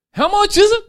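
very high, loud demand; wife demanding the price again, high and loud; wife pressing. Acted. Wife repeats question in joke.

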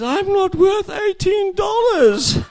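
very high, loud, slow wounded cry; hammed-up heartbroken wife; hurt wife. Wife leaves in tears over the price, hammed-up hurt cry. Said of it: "She says".

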